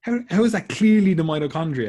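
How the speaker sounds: student loudly questioning the lecturer, exasperated; incredulous outburst. Retold or imitated. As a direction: demand it in loud, high outrage. Mock outrage at lecturer's claim.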